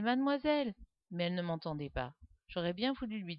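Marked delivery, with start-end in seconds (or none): 0.0-0.8 put-on louder, higher call after a girl. A narrator's reading. Boy calling after the girl he loves, loud call. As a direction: call out to her across the street. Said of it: "J'ai crié après elle".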